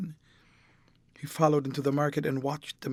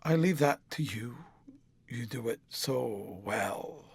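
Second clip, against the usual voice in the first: bitter; 4 semitones lower, 5 dB quieter than usual. A narrator's reading. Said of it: "he answered bitterly".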